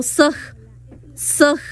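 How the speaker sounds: careful Zoque pronunciation of soj; demonstrating the Zoque word soj. Shown as a demonstration. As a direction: say soj slowly with a glottal close. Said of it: "Por ejemplo, yo digo".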